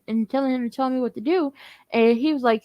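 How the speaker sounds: usual speaking voice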